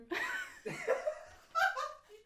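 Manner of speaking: high-pitched laughing fit; laughing so hard it turns to tears; helpless high laughter. Real, not acted. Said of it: "You're crying?".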